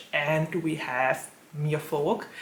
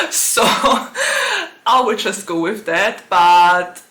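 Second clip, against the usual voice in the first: loud, high burst of laughter breaking into slower words; real laughing; 5 semitones higher, 8 dB louder, slower than usual. Real, not acted. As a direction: crack up at your own remark, then talk through it. Laughs at own Ariel remark.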